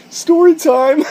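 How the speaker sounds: breaking up mid-phrase, high and loud; fighting a laugh, bursting out. Real, not acted. Vlogger's intro cracking into laughter. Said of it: "I can't even say it with a straight face".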